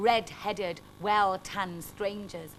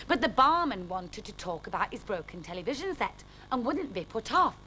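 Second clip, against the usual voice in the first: plain reading voice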